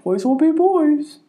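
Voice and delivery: slow, dismissive dad voice. Retold or imitated. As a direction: shrug it off like a lax dad.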